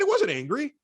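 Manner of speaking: high-pitched, mildly agitated denial; retold denial with a touch of agitation; retold upset denial. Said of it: "you sound like Joe Pesci when you get upset".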